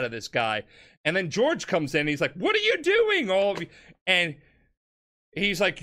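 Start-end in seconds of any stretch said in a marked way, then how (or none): 2.3-3.7 much higher, scandalised shout; a father catching his boys fighting; shocked father yelling. Retold or imitated. Father George bursting in on the fighting sons, shouting in shock.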